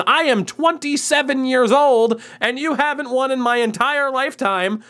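rising taunt at the team; gleeful mocking rant. Real, not acted. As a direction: crow over a rival's failures, half laughing at them. Gloating rant at the Canadiens.

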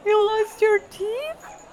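slow, drawn-out sympathy; mock pity. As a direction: drag out the pity like to a child. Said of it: "is like".

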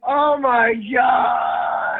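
drawn-out wailing oh my god, high and loud; real half-sobbing shout of relief; anguished cry. Real, not acted. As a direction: cry out in mock despair. Caller greeted after failed call.

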